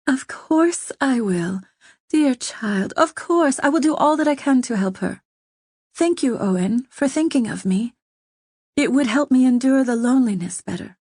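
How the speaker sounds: lady answering with sympathy and delight; Catherine, tender delight. A narrator's reading. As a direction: voice Catherine moved and kind. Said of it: "expression changed to one of sympathy and delight".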